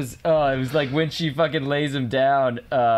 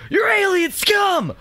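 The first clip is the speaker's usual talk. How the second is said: high, slow shouted accusation; shouted movie quote; slower than usual. Retold or imitated. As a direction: yell it like a crazed accuser. Quoting movie line.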